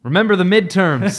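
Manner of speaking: loud, high sudden shout; mock rallying cry. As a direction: belt it out like a joke slogan. Sudden mock political call-out.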